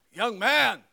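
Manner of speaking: a stern teacher's call down an empty hallway. Retold or imitated. Teacher stops a truant boy in the hall, stern call. Hall monitor calling out to skipper.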